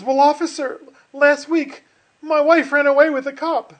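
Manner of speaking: hammed-up joke character's excuse; pleading driver. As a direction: plead your case to the officer.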